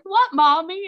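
put-on high, loud little-kid question; four-year-old son. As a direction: imitate a puzzled small boy. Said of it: "He's like".

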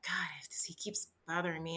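unvoiced, hushed inner grumble; whispered thought. Rider muttering about the pestering motorbike man, whispered. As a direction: whisper it to yourself, annoyed. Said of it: "And so finally I'm like".

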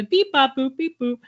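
lightly mimicked robot beeping, rising; robot noises. Storyteller copying the kid next door, robot noises. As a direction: beep and boop like a kid playing robot. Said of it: "like, robot noises".

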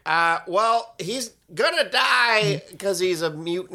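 high, slow nasal-free Lynch impression; David Lynch voice. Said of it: "David Lynch was like".

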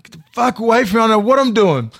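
high, loud panicked yell; retold yell. Rattled man yelling at friend in car, high loud panic. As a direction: yell him away, rattled and scared. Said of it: "I said".

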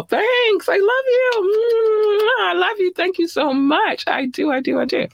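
very high, drawn-out I love you; gushing high thanks. Real, not acted. Host gushing to listeners, very high delighted thanks. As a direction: squeal with delight.

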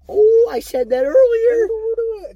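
high, loud, slow laughing whine; laughing squeal. Real, not acted. Caught repeating herself, squealing with laughter. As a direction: squeal through laughter at yourself.

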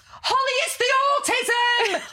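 high, loud, slow declaration; dramatic mum. Retold or imitated.

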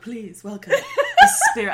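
high, loud announcement through laughter; mock announcer. Host imagining the spirit of Canada's entrance, laughing mock announcer. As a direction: announce it grandly while cracking up. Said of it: "they open a door and they're like".

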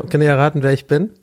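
usual speaking voice

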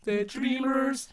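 slow, high frog croon. Acted. Kermit singing Rainbow Connection.

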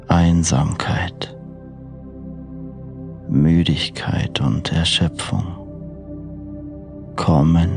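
soft, slow sleep meditation voice; guided meditation. Acted. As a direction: lull the listener toward sleep gently. Freigesprochene Einschlafmeditation.

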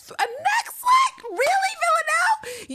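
very high, drawn-out incredulous shriek; outraged disbelief. Real, not acted. Fan berating Villanelle for a mere neck slit, outraged disbelief. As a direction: stretch it out in high-pitched outrage. Incredulous outburst at a weak kill.

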